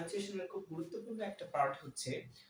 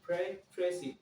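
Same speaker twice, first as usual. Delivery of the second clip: Pre-, presi- with the P pressed hard and a little louder; stress on P; 4 dB louder, much slower than usual. Shown as a demonstration. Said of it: "পি এর উপর একটু চাপ প্রয়োগ করবো".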